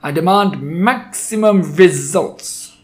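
high, loud, slow demand; shrieked. A narrator's reading. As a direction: stretch out the order imperiously. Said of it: "shrieked the Grand High Witch".

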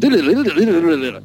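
high, rapid nonsense-syllable keyboard run; imitated keyboard riff. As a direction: babble out a frantic keyboard lick. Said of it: "these keyboards going".

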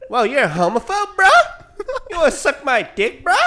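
high, loud laughing bro voice; cackling macho taunt; mock tough guy. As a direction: goad like a meathead bro.

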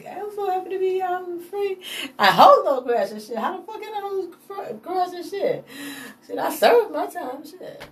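high put-on voice, hamming up the forgiveness; mock freed inmate. Speaker voicing a freed rival swearing he holds no grudge, high mock voice. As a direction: play the freshly released guy, high and cocky.

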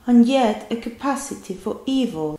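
exaggerated British English imitation; imitating British accent. Shown as a demonstration. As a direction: copy the British clip, overdoing each sound. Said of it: "exagera cada sonido". (imitando el acento británico exagerado)

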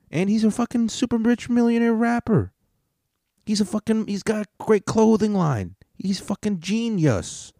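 high, gushing fanboy voice; mock hype. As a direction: gush like an awestruck fan. Mocking excited [name] fan voice.

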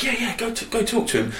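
high mocking voice of the mates; hammed-up high nudge to go on; egging on. Mates in the video egging him on, in a high mock voice. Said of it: "So they're like".

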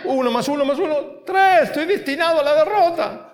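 rising, whiny complainer counting his odds; whiny defeatist. Pretend. Preacher mocks a believer facing giants, whining and rising. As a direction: whine like a believer who expects defeat. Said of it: "whiny defeated believer voice".

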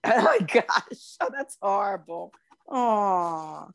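drawn-out, appalled reaction; real pitying dismay, slow to start. Real, not acted. Hearing that Australians eat kangaroo, dismayed.

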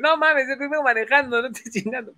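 high-pitched mock irritation; exasperated ex. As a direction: brush off a caller in an imitated high voice. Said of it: "Sandra, que era mi ex". (fastidio agudo, imitando a la ex)

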